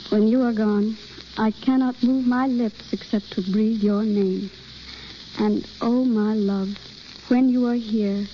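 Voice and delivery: rehearsed love lines, evenly paced and low; love speech recitation. Acted. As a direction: recite tenderly as if your lover stands before you. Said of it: "Picture the man you love".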